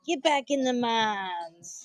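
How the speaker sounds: put-on drawn-out country accent, slowed down; country accent joke. Coin dealer teasing his crew to get back to work, in a slow country accent. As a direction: drawl it like a country boss sending the boys back to work. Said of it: "We shout".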